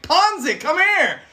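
hammed-up call before hurling him; goofy giant shout. Goliath player yelling for Ponzi before throwing him, loud. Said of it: "I was like".

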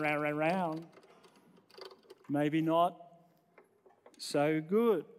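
usual speaking voice

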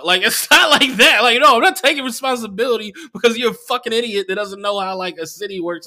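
loud, high-pitched refusal; exasperated rant. Retold or imitated. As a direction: refuse him at full volume. Ranting at the absent comic.

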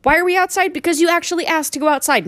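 high-pitched rhetorical complaint; exasperated berating. Acted. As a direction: demand an answer from the dog, exasperated. Acting out berating the dog outside.

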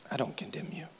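soft, breathy whisper of mercy; whispered tenderly. Acted. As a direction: hush it like a secret of grace. Jesus' words whispered tenderly.

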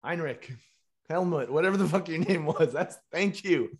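loud, high, elated shout of gratitude; mock called-out thanks. Storyteller thanks the stranger who sent them right, acted loud call. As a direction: call back to a stranger, playing up the relief.

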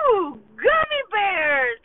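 put-on slow, high squeal of a kid; delighted child spotting candy; mock kid squealing over gummy bears; excited kid. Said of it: "and they're like".

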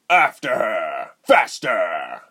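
loud, drawn-out villain shout; screamed order. Acted. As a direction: urge pursuers on with a shout. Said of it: "she heard Reuben scream".

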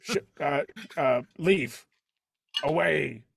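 put-on gruff commanding accent for Cook; character accent. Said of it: "giving Cook this accent".

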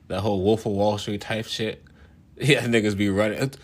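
ordinary talk, nothing marked

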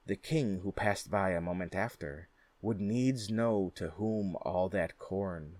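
ordinary delivery, nothing marked

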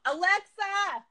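called out across the room; yelling. Real, not acted. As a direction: shout the name to the speaker. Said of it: "I have to yell".

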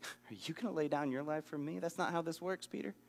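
quiet, wry Jesus rebuke; soft quick rebuke. Shown as a demonstration. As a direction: gently turn the question back on Peter. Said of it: "Jesus just flips it right on Peter".

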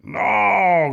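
high, loud, drawn-out cry of protest; wolf's shout. A narrator's reading. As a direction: yell it out long and high. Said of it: "gritó el lobo". (grito de protesta)

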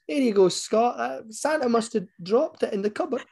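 high-pitched, innocent mum; squeaky mum covering for Santa. Retold or imitated. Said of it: "my mum then goes".

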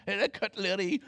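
mock Scottish villager, cheerfully failing; bad Scottish accent. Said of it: "I can't do a Scottish accent".

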